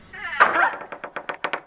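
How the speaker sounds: a sudden high shriek of fright; startled scream. Real, not acted. Co-host screams at a noise in the haunted room. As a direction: jump and let out a short scream. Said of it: "You screamed".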